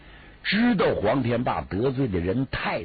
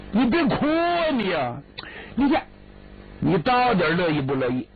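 higher, anxious begging to stop crying; a helpless brother thrown by her tears; flustered pleading; 7 semitones higher than usual. Acted.

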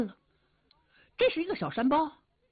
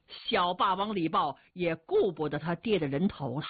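ordinary delivery, nothing marked

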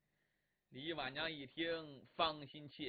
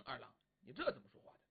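quick protest; 7 semitones lower, 6 dB quieter, much faster than usual. Acted. Husband protests.

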